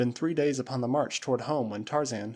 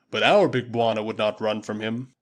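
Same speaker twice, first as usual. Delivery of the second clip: booming Waziri warrior; 2 semitones lower, 5 dB louder than usual. Acted. Usula answering his master Tarzan, loud and proud. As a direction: call it out like a loyal tribesman.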